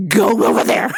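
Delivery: laughing through the order. Real, not acted. Daughter laughing as she sends dad over, laughing. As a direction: crack up while giving the order.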